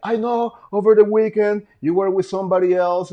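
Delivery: high-pitched mimicry of an accusing ex; imitated ex. Ex confronting him over the weekend, imitated high. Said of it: "she gets on the phone like".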